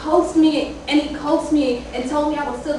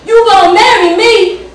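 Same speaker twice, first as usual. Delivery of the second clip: much louder and higher, hurled outward; calling out to the neighbors; 9 semitones higher, 14 dB louder than usual. Acted. Father shouting at the neighbors from the window, loud demand. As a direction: bellow it to the whole street. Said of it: "Say to all the neighbors".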